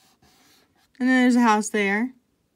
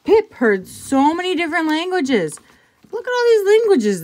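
higher, louder wonder at languages; excited storytelling; 9 semitones higher, 6 dB louder than usual. A narrator's reading. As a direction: read it with wide-eyed wonder. Reading aloud with excitement.

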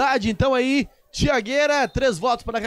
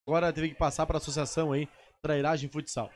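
normal talking voice

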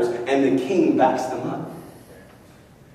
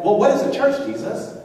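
mock question; 7 semitones higher than usual.

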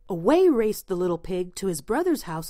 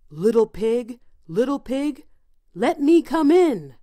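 loud, lightly voiced wolf; big bad wolf; 4 semitones higher, 7 dB louder than usual. A narrator's reading. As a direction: call out like a hungry wolf. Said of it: "cried the wolf".

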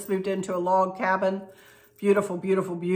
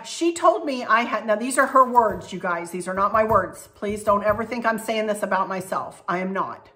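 ordinary talk

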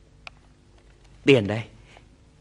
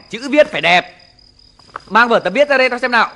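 usual speaking voice

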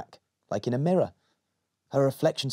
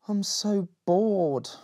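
sighing; 7 semitones higher, much slower than usual. Acted. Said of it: "she sighed".

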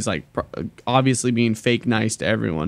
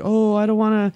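higher put-on girly voice; imitating the girl; 9 semitones higher than usual. Mocking a girl character from the show, higher girly voice. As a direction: mimic the anime girl, airy and pleading. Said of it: "And that girl's like".

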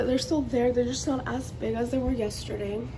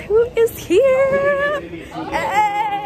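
excited; 11 semitones higher, 7 dB louder, much slower than usual. Real, not acted. Friend arrives in Vegas.

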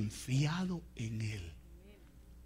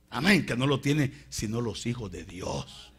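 usual speaking voice

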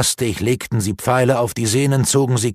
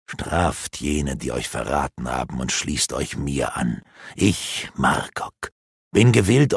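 lower, sneering villain voice; dark elf mocking the captured apprentices; gloating dark elf; 8 semitones lower than usual. A narrator's reading. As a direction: taunt them with malice.

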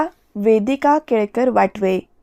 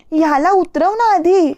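higher-pitched, alarmed plea; a touch of panic, pitched up; frightened; 7 semitones higher than usual. A narrator's reading. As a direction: plead in fright, voice raised in pitch. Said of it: "अक्षता घाबरलेली असते".

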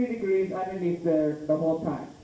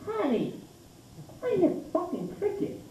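much higher, hushed wife voice; irritated wife; 13 semitones higher, 4 dB quieter than usual. Retold or imitated. Irritated wife to husband on a cave tour, high complaining voice.

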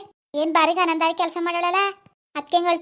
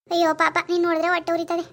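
ordinary talk

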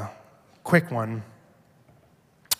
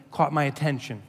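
ordinary delivery

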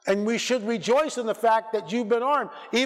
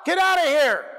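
shouted rebuke; 8 semitones higher, slower than usual. Shown as a demonstration. Preacher demonstrating casting out a demon, shouting. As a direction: drive it out with each word. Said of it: "Get angry".

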